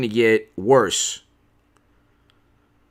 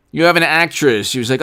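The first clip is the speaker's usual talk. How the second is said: ordinary delivery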